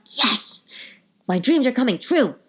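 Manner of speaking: high, gasping mock rapture; overjoyed. Shopper thrilled at new leggings, overjoyed. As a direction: act out a dream come true. Acting out joy at leggings' release.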